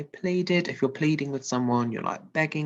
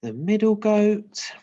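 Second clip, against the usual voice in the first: loud; 8 semitones higher, 6 dB louder than usual. Shown as a demonstration. Loud read.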